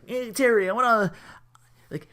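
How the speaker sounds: high-pitched imitation of a little kid; eager kid. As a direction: plead like an admiring kid, haltingly. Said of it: "he's just some kid just like".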